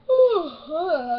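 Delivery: big loud exasperated sigh; loud weary sigh. Real, not acted. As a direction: heave a tired sigh. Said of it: "I'm tired".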